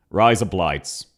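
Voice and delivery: "Rise up lights" run together like Aussie razor blades; low, run-together Aussie "Rise up lights"; Australian-sounding accent. Shown as a demonstration. Streamer revealing how Aussies would say it, low Australian-sounding run-together words. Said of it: "That is how Aussies would say".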